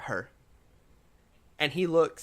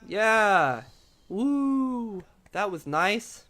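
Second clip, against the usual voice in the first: half-hearted cheer; 8 semitones higher, much slower than usual. Acted. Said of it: "small spattering of applause".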